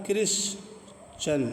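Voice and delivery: क्रिश्चन said slowly, t as ch. Shown as a demonstration. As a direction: draw out क्रिश्चन, ch not t. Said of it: "टी आई ए".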